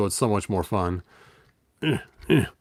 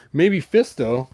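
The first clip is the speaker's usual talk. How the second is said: usual speaking voice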